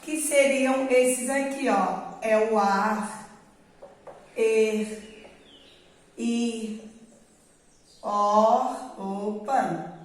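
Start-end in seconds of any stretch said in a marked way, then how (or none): 2.6-8.8 "er", "ir", "or", "ur" each ending in a throaty scraping R; isolated vowel plus raspy R; syllable-final R. Shown as a demonstration. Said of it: "primeiro eu vou ler a sílaba e depois eu ponho o R".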